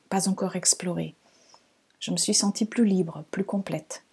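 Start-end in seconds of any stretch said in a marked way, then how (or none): none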